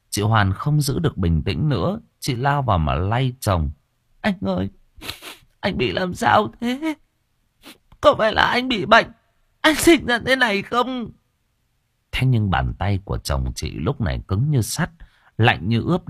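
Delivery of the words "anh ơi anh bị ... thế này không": pleading wife. A narrator's reading. Wife shakes husband pleading.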